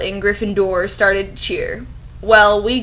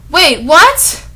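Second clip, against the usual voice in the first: high, loud wait what; stunned double take; shocked; 8 semitones higher, 8 dB louder than usual. Real, not acted. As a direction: blurt it out in disbelief. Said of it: "shocked reaction".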